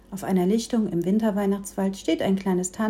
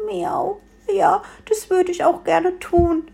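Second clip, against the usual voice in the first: high, small cat voice; kitten voice; 10 semitones higher, 4 dB louder than usual. A narrator's reading. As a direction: speak like a little cat. Cat character answers the tree.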